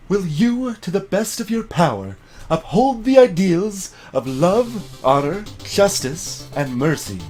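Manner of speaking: priestess voice. Acted. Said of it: "what was the other priestess voice?".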